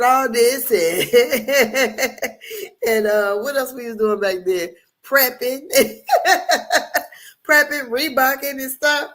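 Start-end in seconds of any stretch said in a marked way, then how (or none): 2.2-2.8 laughter. Real, not acted. Laughing at her teen dancing. 5.7-7.1 laughter. Real, not acted. Laughing about prepping days.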